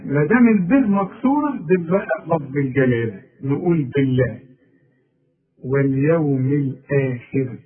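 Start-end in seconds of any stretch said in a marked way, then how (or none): 5.7-7.6 واليوم الآخر slow, all letters light; واليوم الآخر unhurried, nothing made heavy; slow correct model. Shown as a demonstration. Teacher reciting the phrase slowly for the student. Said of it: "مرققة كلها".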